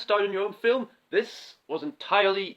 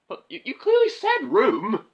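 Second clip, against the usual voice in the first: indignant protest; 7 semitones higher, 6 dB louder than usual. Acted. Arguing with offscreen voice.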